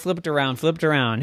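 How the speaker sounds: usual speaking voice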